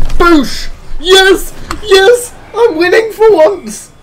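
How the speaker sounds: high, loud cheering; triumphant yells. Real, not acted. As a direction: celebrate the goal at the top of your lungs. Scores a goal at last.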